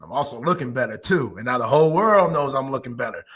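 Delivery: low, unhurried imitation of a pleased boss; Jerry Jones. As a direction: drop your voice and play a self-satisfied older-boss thank-you. Co-host voices a pleased Jerry.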